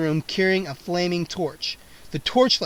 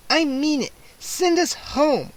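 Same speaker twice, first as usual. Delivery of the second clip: put-on much higher, very slow girl's order; 9 semitones higher, slower than usual. A narrator's reading. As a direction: command him firmly. Kara orders.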